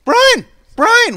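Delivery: put-on high, loud excited child's shout; imitating a child calling out. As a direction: call the name like an eager little kid. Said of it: "guess what he says".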